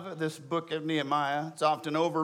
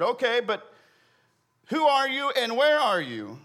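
high-pitched, raised questioning voice; acted urgent questioning; 6 semitones higher, 3 dB louder than usual. Man on the branch questions the unseen voice, acted urgency. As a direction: demand to know, in mock alarm.

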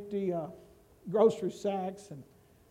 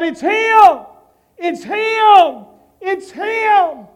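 loud, much higher, excited cry; joyful shout; 10 semitones higher, 13 dB louder, much slower than usual. Acted. Believers spotting the Lord in the sky, joyful shout. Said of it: "we'll look up and shout".

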